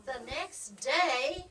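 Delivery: ordinary delivery, nothing marked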